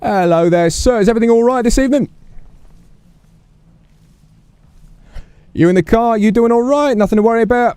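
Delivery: chirpy bobby greeting a driver; cheery officer. Acted. Detective greeting man in car, high cheery voice.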